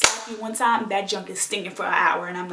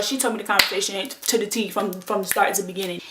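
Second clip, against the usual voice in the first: normal talking voice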